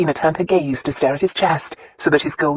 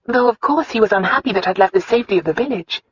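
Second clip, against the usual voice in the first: quick, higher-pitched wife explaining; brisk, earnest explanation; Christina's voice; 7 semitones higher, faster than usual. Acted. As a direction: hurry through it in the pitched-up heroine's voice. Voices Christina telling her story.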